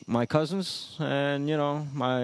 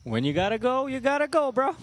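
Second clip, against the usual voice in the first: sing-song catchphrase; 10 semitones higher than usual. Pretend. Sing-song catchphrase, high pitch.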